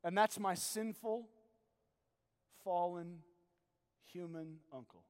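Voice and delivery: soft, low pastoral voice drawing the listener in; hushed sermon voice asking the congregation; intimate preaching. Acted.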